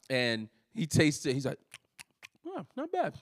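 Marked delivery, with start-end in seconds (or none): 2.5-3.1 a much higher, softer mock dad, mildly impressed; understated dad verdict. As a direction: play the dad grudgingly pleased. Son imitates dad tasting fish.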